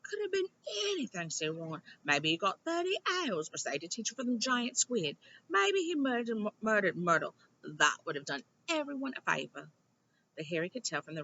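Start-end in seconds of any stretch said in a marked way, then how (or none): 0.0-1.3 high Ron voice. Acted. Ron voiced much higher. 2.0-9.7 joking Ron. Acted. Ron joking about Riddle.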